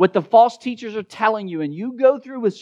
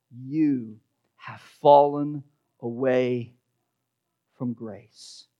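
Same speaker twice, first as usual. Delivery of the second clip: hushed grave reading; 6 semitones lower, 6 dB quieter, slower than usual. Acted. Preacher reads the verse's grave verdict.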